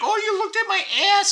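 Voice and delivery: high-pitched mock accusation; indignant gym woman. A gym-goer mimics a woman accusing him of looking, mock indignant.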